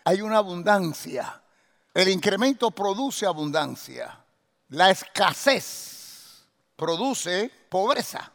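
ordinary talk, nothing marked

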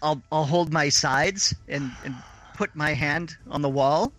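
ordinary talk, nothing marked

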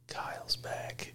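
slow hushed whisper; dramatic whisper. Acted. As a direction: whisper it like a secret reveal.

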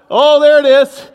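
loud, high burst of recognition; excited exclamation. Real, not acted. Pastor hearing lutefisk called from the back, excited. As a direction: cry out as if you've found it. Spots lutefisk answer from crowd.

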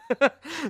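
short high chuckle; a quick amused burst; real laugh. Real, not acted. Laughs before next segment.

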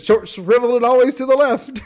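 high, loud comic comeback; Fletcher. Retold or imitated. Answering the other attorney's greeting, mock comeback. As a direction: fire it back high, climbing to the end. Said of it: "And he goes".

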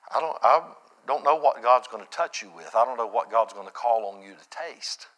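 normal talking voice

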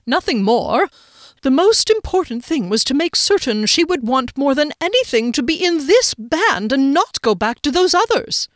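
builds to high, loud insistence; rising volume. A narrator's reading. Boss getting carried away defending himself, rising volume. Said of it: "his voice had raised in volume".

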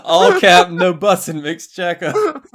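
loud, high, laughing slang parody; laughing shout. Pretend. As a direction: yell it through laughter. Repeating the slang joke louder.

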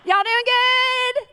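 high, slow, drawn-out Southern drawl; Southern accent. Retold or imitated. Mimicking a Southern greeter's accent in a high drawl. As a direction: put on a thick Southern twang. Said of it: "I'm from Queens".

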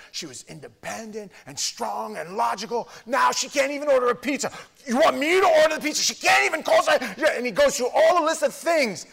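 high, loud exasperated yelling; complaining husband rant. Retold or imitated. Husband in counseling complaining about his wife, yelling. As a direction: whine it out loud like a fed-up husband.

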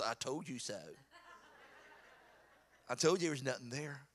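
low, soft imitation of a wife; understated, low-pitched I-told-you-so; wife's told-you-so. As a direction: say it quietly, as a knowing aside.

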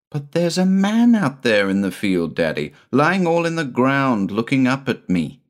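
put-on high, breathless child's voice; excited little boy. A narrator's reading. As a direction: rush it out like an eager boy.

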